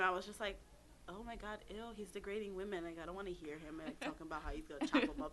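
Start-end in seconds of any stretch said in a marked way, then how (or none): 1.1-1.6 put off. Retold or imitated. Retelling first time hearing the rapper, put off. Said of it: "The first time I heard him". 1.7-5.3 low, soft, quick grumble of distaste; understated retold "ew" reaction, hurried and quiet; disgusted. Retelling first impression of the rapper, disgusted. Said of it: "The first time I heard him".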